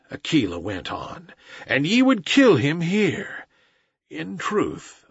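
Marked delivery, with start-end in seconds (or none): none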